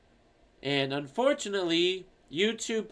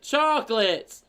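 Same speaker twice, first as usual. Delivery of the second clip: louder, higher, drawn-out repeat; raised voice; 5 semitones higher, 5 dB louder, slower than usual. Acted. Daughter repeating it to hard-of-hearing mom, raised voice. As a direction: call it out slowly for a deaf parent.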